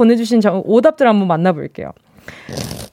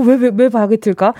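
ordinary delivery

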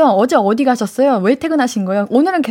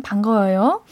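normal talking voice

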